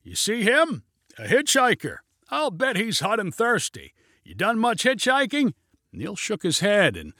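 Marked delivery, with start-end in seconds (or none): 0.0-5.5 high, eager raised voice; mildly excited, pitched up; excited calling. A narrator's reading. Pilot chats about hitchhiker to passenger, mildly excited call.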